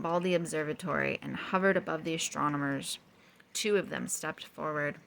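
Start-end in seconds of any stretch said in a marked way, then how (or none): none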